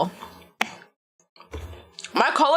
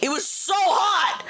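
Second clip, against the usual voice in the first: hot complaint; 6 semitones higher, slower than usual. Real, not acted. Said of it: "repeats complaint about heat".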